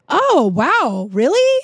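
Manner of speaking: swooping up to a high questioning end; imitated surprise. Bartender hearing the regular is sober, surprised. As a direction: play the bartender's wowed reaction, lifting sharply.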